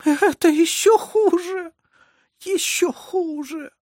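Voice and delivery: high, drawn-out anguished wail; tearful lament. A narrator's reading. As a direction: plead in slow, high-pitched despair. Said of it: "прошептала плачущая женщина".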